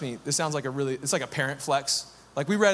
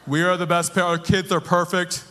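laughing, louder mock boast; smug parent; 4 dB louder than usual. Mocking a smug parent bragging about perfect kids, laughing. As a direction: ham up a self-satisfied parent. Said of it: "Here's how you know you're a bad parent".

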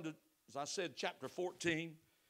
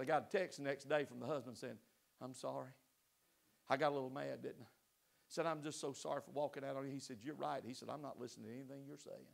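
quiet retelling; 6 semitones lower, 8 dB quieter than usual. Retold or imitated. Quoting the contrite husband's text.

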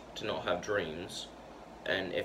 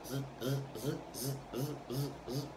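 soft buzzing zzz like a record stuck in its groove; skipping record noise; 6 semitones higher, 8 dB quieter than usual. Shown as a demonstration. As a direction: imitate a scratched record repeating. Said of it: "it's just kinda like".

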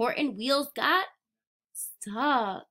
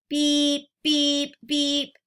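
louder beeping call; modeling beep; 3 semitones higher, 4 dB louder, much slower than usual. Shown as a demonstration. Leading children to join in, loud beep beep. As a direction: show the kids how to beep. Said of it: "Everyone say".

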